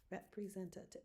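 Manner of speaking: representative said softly, checking the syllables; quiet recount. Shown as a demonstration. Teacher checking her own count, quiet word to herself. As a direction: count it under your breath. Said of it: "I didn't recount".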